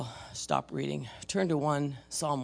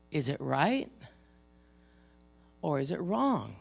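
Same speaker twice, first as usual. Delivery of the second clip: ordinary delivery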